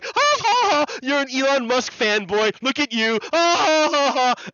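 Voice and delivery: high, loud taunting cackle; hammed-up gloating laugh; mocking laughter. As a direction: laugh right in their face. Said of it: "I'll stand there going".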